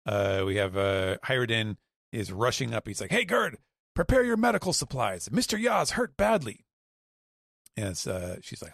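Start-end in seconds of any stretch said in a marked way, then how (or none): 3.1-6.6 urgent call. Retold or imitated. Hyruden rushing up calling out.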